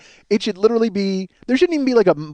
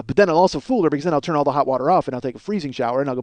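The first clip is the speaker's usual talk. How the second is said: usual speaking voice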